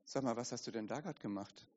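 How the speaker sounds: very soft, low reproach; accusing inner voice. Acted. Inner voice confronting the sinner afterwards, hushed reproach. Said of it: "Diese Stimme setzt wieder an und sagt".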